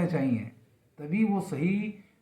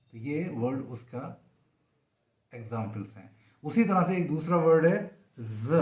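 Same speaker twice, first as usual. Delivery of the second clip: ordinary talk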